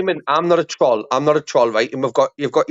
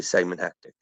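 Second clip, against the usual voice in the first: lower, softer put-on voice; 9 semitones lower, 8 dB quieter than usual. Shown as a demonstration. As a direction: drop the voice low and soft. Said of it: "if I was to speak a bit like this".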